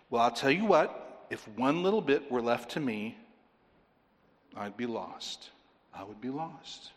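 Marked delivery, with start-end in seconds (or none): 4.5-6.9 much softer, like admitting it to yourself; quiet confession. Real, not acted. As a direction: drop to a hushed, earnest admission. Preacher confesses own helplessness.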